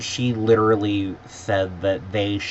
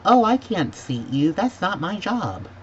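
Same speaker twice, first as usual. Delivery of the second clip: high-pitched mimicry of an unhelpful clerk; mock counter agent; 11 semitones higher than usual. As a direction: brush the customer off in a higher mocking voice.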